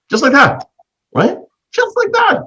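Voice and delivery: high amazed repeat. Real, not acted. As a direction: marvel at how easily it happened. Amazed retelling of the gift.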